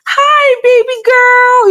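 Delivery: very high, loud, drawn-out gushing greeting; overly dramatic happiness. Acted. Mother greeting her daughter, overly dramatic show of happiness. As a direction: squeal it slowly, hammed-up joy for a baby. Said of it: "overly dramatic, you know, show of expression of happiness".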